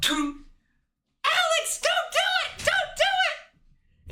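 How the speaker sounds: very high falsetto plea, slow at the end; high falsetto woman's voice begging him to stop; falsetto girlfriend. Acted. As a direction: squeak it out as the girlfriend pleading. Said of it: "And Kat runs up".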